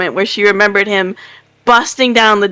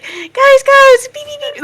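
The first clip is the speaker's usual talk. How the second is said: much higher mock squeaky voice; squeaky beeping; 3 dB louder than usual. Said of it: "you're like".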